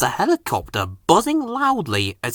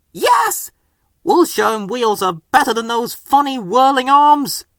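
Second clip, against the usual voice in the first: high, excited little engine; fired up for the race; eager Percy; 9 semitones higher than usual. A narrator's reading.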